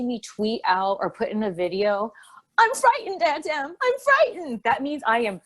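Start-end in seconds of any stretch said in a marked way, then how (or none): 2.6-4.6 put-on high, frightened little girl voice; Dorothy Gale. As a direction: squeak it like a scared Dorothy. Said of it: "and I'm Dorothy Gale".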